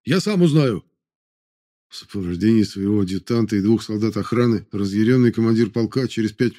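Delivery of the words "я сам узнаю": shouting. Acted. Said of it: "вновь вскрикнул".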